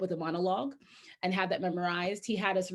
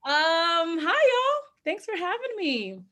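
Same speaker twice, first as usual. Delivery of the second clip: excited greeting; 10 semitones higher, 6 dB louder, slower than usual. Real, not acted. Cheerful greeting to Zoom audience.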